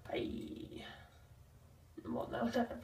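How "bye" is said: soft whispered goodbye; whispering. Real, not acted. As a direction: whisper the bye.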